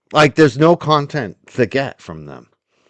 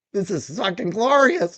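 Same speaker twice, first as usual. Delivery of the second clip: normal talking voice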